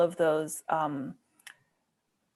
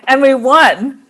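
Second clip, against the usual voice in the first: excited; 6 semitones higher, 17 dB louder than usual. Real, not acted. Joking about a festival win.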